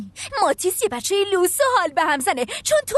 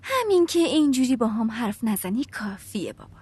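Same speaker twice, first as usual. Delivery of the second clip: whisper; 4 semitones lower than usual. Acted. Sullen teen answers her father.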